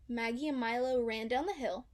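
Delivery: ordinary delivery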